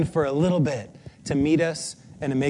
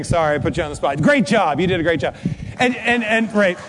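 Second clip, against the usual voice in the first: usual speaking voice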